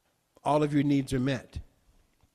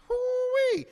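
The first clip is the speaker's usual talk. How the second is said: much higher whoop of delight; ecstatic whoop. Real, not acted. Preacher overcome with joy mid-affirmation. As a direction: let out a joyful hoo-wee.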